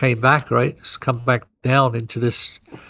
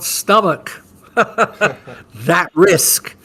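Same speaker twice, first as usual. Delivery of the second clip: laughing; 3 semitones higher, much slower than usual. Real, not acted. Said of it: "There you go. I said it".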